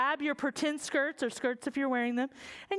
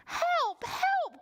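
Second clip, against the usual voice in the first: crying page. Acted. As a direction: call out in a panic, high. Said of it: "cried the page".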